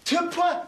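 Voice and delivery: "Two point" as a high, broken "two-pa"; misread "point". Shown as a demonstration. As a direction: mimic the wrong reading "Two point", ending on an open "pa". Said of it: "呢邊係two point". (高聲模仿讀錯「Two point」)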